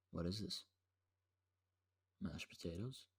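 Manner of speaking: soft, low retold bafflement; mock puzzled question. Brother biting mashed potatoes, quietly puzzled imitation. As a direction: quietly question it, pausing before the guess.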